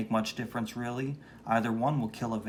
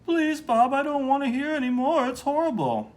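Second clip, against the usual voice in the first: high, pleading timid voice; frightened clerk, pitched way up; 13 semitones higher, 5 dB louder than usual. Acted. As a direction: beg him to stop, much higher. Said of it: "his dialogue has a little stutter".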